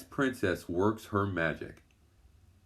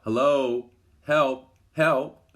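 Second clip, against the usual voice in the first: louder, higher shouts for help; calling for help; 6 semitones higher, 7 dB louder, much slower than usual. A narrator's reading. Trapped under the ice, the girls shout to a shadow above, louder and higher. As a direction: yell up through the ice for rescue. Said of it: "They call out".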